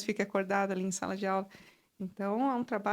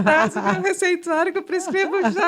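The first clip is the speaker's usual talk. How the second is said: loud, high-pitched teasing with laughs; joking through laughter; 9 semitones higher, 12 dB louder than usual. Real, not acted. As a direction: shout the joke over the laughing. Doctor jokes amid group laughter.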